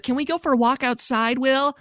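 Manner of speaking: high, whiny plea; pleading Alice. Retold or imitated. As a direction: ask like a pouty girlfriend.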